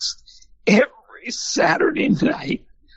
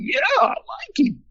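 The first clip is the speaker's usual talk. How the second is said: excited reply; 13 semitones higher than usual. Retold or imitated. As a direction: blurt it out eagerly, pitched way up.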